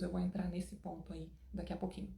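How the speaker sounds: usual speaking voice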